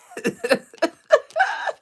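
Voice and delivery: loud squealing laugh; high shrieking laugh. Real, not acted. Laugh after joke.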